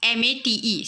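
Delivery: normal talking voice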